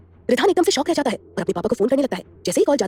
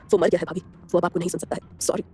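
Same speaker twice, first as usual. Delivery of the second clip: smirking; 6 semitones lower, 4 dB quieter than usual. A narrator's reading. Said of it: "टेढ़ा मुस्कुराते हुए कहता है".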